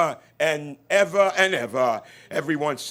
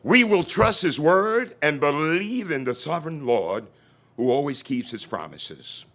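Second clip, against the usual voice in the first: usual speaking voice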